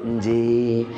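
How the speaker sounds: low, soft deferential yes; a soft low nggih of respect; humble reply. Retold or imitated. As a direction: murmur it as you bow. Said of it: "Dua-duanya nunduk".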